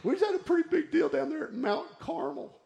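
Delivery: high-pitched mock complaint with a laugh; Elijah. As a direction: pipe up to God in a comic high voice. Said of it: "he's right there in that moment going".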